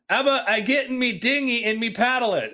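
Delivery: high, badly faked British sailor; hammed-up sort-of-British, pitched well up; not-quite-British accent. Sailor offering to paddle over for dinner, not-quite-British accent. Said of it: "this is not a British accent".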